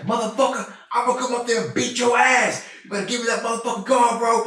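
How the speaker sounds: high, loud mock shout of an angry old man; angry dad shout. The customer's dad threatening him on the phone, high mock shout.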